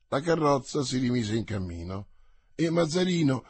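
ordinary delivery, nothing marked

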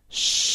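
long hushed hiss of air; breath-like yes. A narrator's reading. Hidden boy answering the spiritists as a ghost, breathy hiss. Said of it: "che pareva un soffio".

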